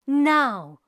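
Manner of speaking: ordinary delivery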